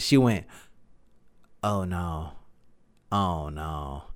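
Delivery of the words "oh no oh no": low, soft ominous "oh no"; imitating mom's dread. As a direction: breathe out a dreading "oh no" the way mom said it. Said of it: "the way that she went ahead and said it was like this".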